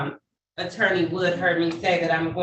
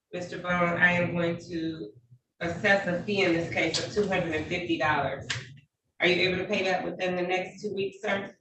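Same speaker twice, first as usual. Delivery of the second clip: ordinary talk, nothing marked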